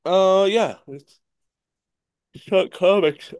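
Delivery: speaking through a yawn; words stretched by a tired yawn. Real, not acted. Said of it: "I keep yawning".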